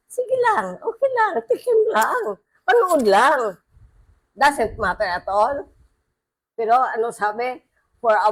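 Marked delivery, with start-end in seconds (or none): 0.1-3.6 high coaxing tempter's voice; tempting Satan voice. Acted. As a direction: lure them sweetly like the devil. (mapang-akit na boses ni Satanas)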